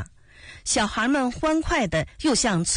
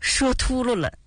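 lightly sheepish, higher voice; amused admission; 4 semitones higher than usual. A narrator's reading. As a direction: own up to the slip good-humouredly. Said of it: "二丫笑着说". (带着笑意地说)

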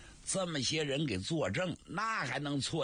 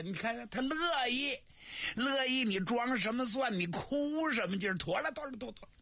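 higher, gleeful teasing; delighted brother; 10 semitones higher than usual. Acted. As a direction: crow that it worked. Li Jun delighted it worked.

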